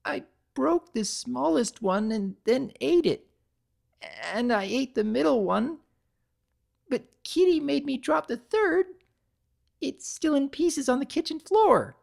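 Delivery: high, bubbly pony voice; Pinkie's voice. A narrator's reading. Pinkie confesses to Twilight, in a high pony voice. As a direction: sound like a guilty, upset little pony.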